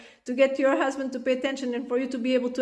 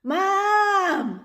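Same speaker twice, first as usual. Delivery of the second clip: put-on child calling Mom higher, rising to a shout; louder calling; 8 semitones higher, 4 dB louder than usual. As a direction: call out Mom, each louder.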